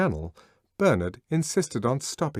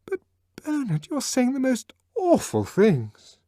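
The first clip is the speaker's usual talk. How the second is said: much higher, louder, dismayed; Lenina shocked; 5 dB louder than usual. A narrator's reading. Lenina to Bernard, much higher dismay. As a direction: cry it out in dismay.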